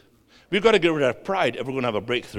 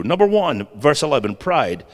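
usual speaking voice

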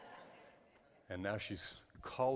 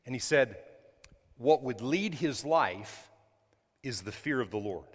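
ordinary delivery

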